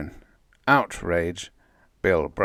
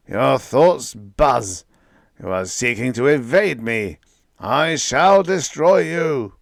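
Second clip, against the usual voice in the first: slow, loud, higher, outraged threat; outraged cosmic voice; 5 semitones higher, 7 dB louder, slower than usual. A narrator's reading. Cosmic Voice outraged at Bill, slow and loud. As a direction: crush the insolent creature. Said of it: "Back came the Voice, outraged".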